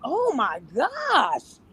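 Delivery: very slow drawn-out sigh of a fed-up man; mock weary "oh my gosh"; exasperated man. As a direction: let it out like a tired boyfriend. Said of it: "men be like".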